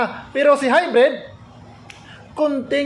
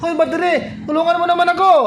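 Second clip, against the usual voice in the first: higher, pleading cartoon voice for the solar panel; solar panel begging voice; 6 semitones higher, much faster than usual. Solar panel begs the battery for help, higher pleading mock voice. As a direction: play the needy solar panel calling the battery. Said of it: "Ganon yung boses niya".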